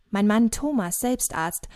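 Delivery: plain reading voice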